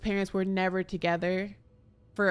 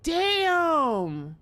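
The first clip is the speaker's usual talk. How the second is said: surprised outcry; 8 semitones higher, 5 dB louder than usual. Real, not acted. Reacts to sad letter.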